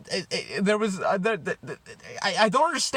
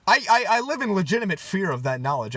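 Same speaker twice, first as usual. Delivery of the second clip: ordinary talk, nothing marked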